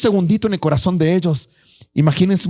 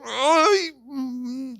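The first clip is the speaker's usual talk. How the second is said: lamenting; 4 semitones higher, much slower than usual. Acted. Old men lamenting the temple.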